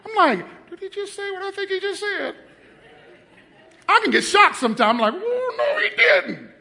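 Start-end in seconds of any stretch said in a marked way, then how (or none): 0.7-2.3 high, hushed disbelief; incredulous mock shock. Retells shocked reaction to remark. 5.2-6.5 high-pitched, drawn-out disbelief with a laugh; comic mock shock. Preacher replaying his shock for the church, hammed-up. Retells shock at pastor's remark.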